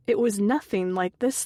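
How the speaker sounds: plain reading voice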